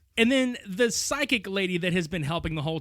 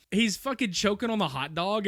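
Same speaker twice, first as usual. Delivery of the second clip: usual speaking voice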